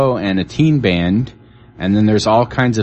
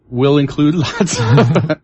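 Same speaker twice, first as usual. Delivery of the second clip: laughing; 5 semitones higher than usual. Real, not acted. Laughs while reading event blurb.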